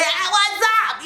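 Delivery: high, strained mock yell; hammed-up yell at a high pitch; demo yell. Shown as a demonstration. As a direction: pretend to yell the greeting. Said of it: "But if I'm yelling".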